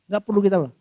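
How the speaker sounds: ordinary talk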